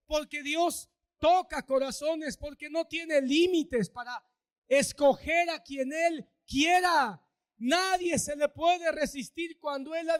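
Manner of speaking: slow, rising to a loud shout on "quiera"; building shout. Real, not acted. Pastor exalting God's power to the flock, building shout. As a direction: build each phrase till it bursts.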